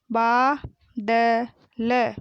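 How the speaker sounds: बादल drawn out as baa, da, la; very slow बादल, each letter held apart; spelled out. Shown as a demonstration. Teacher sounding out बादल for pupils, syllable by syllable. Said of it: "मैंने इस तरीके से पढ़ा".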